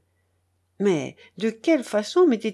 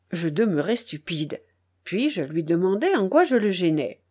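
usual speaking voice

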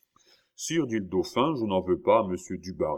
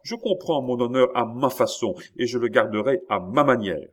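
higher, much louder ranting king; put-on furious tirade; 4 semitones higher, 6 dB louder than usual. King ranting at his ministers, feigned loud fury. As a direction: rant as if outraged, mostly for show. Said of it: "cria le roi en jouant la fureur".

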